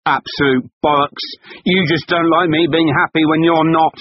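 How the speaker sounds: high, loud scornful retort; angry retort. Acted. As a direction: scoff and hit back hard. Husband snapping back.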